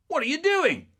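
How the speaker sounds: high, loud startled demand; dad bursting in. Retold or imitated. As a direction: bark it as the dad catching the kids. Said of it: "my dad bursts in and he goes".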